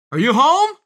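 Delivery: loud, high calling-out question; yelling. A narrator's reading. Visitor outside asks if Rabbit is home, yelling. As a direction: holler to see who is in. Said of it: "someone yelled outside".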